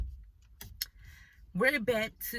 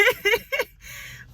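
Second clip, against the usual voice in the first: a high burst of laughter; high shocked laugh; 6 dB louder than usual. Retold or imitated. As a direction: laugh high and sudden at the absurdity. Laughing at her own shock.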